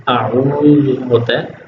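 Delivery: usual speaking voice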